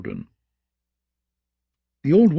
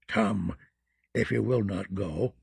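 put-on low, soft muttering old crone; terrible deep voice; 5 semitones lower, 7 dB quieter than usual. A narrator's reading. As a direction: mutter like an ancient witch. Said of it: "her terrible, deep voice".

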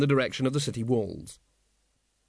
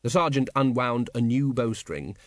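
usual speaking voice

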